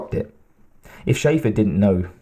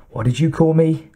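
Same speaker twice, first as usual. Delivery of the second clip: higher, sharp outraged demand; 6 semitones higher than usual. Acted. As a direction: bristle at the insult. Insulted captain flares up.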